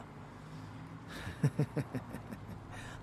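soft, wicked vampire chuckle; sinister chuckle. Acted. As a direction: chuckle darkly to yourself. Vampire boasts to protégé.

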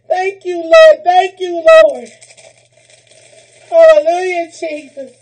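loud, fervent thanks to the Lord; loud praise. Real, not acted. Thanking the Lord for gifts, loud fervent praise. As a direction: call it out in praise.

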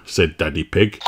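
ordinary delivery

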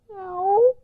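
high contented mew; a satisfied little mew; kitty meow. Acted. A full kitten mewing its thanks. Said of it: "the little kitty says".

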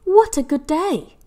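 delighted little cat; happy. Acted. Hetty's happy thought.

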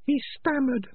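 high, sharp little rat voice; Peaches voice. Acted. Peaches tells Morris, in a high rat voice.

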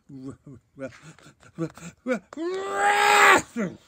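stuttered "r-" exploding into a high, loud sneeze; building mock sneeze. Narrator's "rumbled" overtaken by Bear's sneeze, stuttering build-up. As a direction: catch on the sound, then burst.

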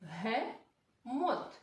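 slow, chopped Бегемот with a laugh; Бегемот by syllables, laughing. Shown as a demonstration. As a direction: split Бегемот into be-ge-mot slowly. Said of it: "Ще раз поділимо".

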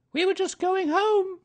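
brisk motherly sing-song. A narrator's reading. Kanga tells the friends they are going home, very high.

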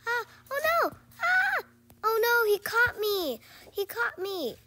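high, loud shrieks easing slightly; panicked screaming. Real, not acted. Player caught by the game monster, panicked.

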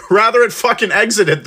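ordinary delivery

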